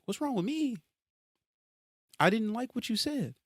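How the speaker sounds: high, indignant disbelief; incredulous. Real, not acted. Answering someone who asked what's wrong, with high indignant disbelief. Indignant retort to imagined critic.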